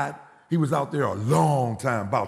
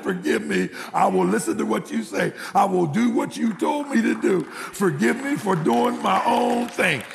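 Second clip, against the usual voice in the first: rising preacher's plea to God; impassioned retold prayer of surrender; fervent repentance; 6 semitones higher than usual. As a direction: build higher with each vow, stretching the end. Preacher retells his prayer of repentance.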